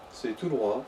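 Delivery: ordinary delivery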